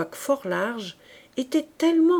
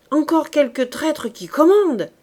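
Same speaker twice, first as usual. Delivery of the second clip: loud, higher bitter cry; exclaiming; 6 semitones higher, 8 dB louder than usual. Acted. Corporal railing against traitor officers, loud and bitter. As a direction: shout the accusation at the officers. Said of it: "s'écria le caporal".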